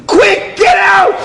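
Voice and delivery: drawn-out, high, loud order; urgent shout. Retold or imitated. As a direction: shout them out of the taxi. Taxi driver shouting at couple.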